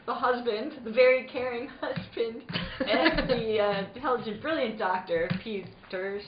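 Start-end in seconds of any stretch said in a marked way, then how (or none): none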